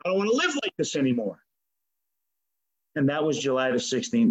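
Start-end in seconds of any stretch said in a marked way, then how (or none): 0.0-1.4 retold scream. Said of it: "and I screamed out".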